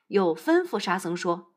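ordinary delivery

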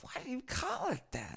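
甲高い声で相手を真似る; high-pitched mock of the offended cohost. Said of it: "and then he's like".

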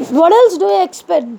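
ordinary talk

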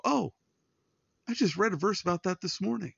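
light up as if it just clicked; surprised realization. Retold or imitated. Friend recalls verse read earlier.

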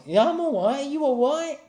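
high, loud London reply; London accent greeting. Retold or imitated. Customer greets the London girl back, high London accent. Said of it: "how you greet each other".

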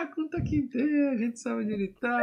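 high-pitched mocking barber, slowing at the end. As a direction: squeak it out like an eager old barber. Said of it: "O cara".

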